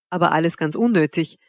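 ordinary delivery

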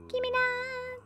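very high, drawn-out tiny voice; playful squeaky demand. Pretend. Player grabbing at an item, playful falsetto plea. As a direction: stretch it into a soft falsetto. Grabbing items, escalating repeats.

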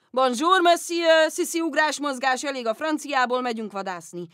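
loud, booming father; a hearty yell to call the girl away; father shouting. Retold or imitated. Father interrupts French lesson, booming and loud. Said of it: "Ott volt Monsieur Gachet meg anya".

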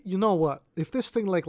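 ordinary delivery

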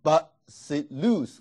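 "but, sit, loose" slowly, each syllable set apart. Shown as a demonstration. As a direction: break it into three slow syllables. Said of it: "三个音节". (分三个音节慢读but sit loose)

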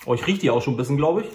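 ordinary delivery

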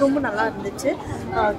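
ordinary talk

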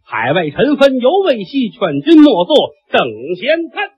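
chanted opening verse; measured classical poem delivery; poem recitation. Acted. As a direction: recite it like a storyteller's prelude.

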